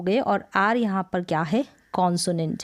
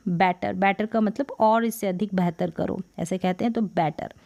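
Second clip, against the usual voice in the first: ordinary talk, nothing marked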